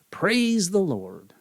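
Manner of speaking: high, slow, drawn-out preacher intonation; preacher's holy command. Acted. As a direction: sing it out like a revival preacher. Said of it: "he says".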